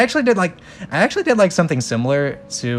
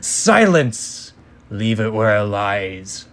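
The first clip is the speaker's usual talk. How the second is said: commanding villain; 2 semitones lower, slower than usual. Acted. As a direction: snap the order like a tyrant. Dark lord silences servants.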